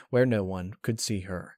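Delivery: ordinary delivery, nothing marked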